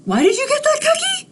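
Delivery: a jealous bird demanding again; rapid-fire squawking pigeon; angry pigeon. Acted. Pigeon repeats faster.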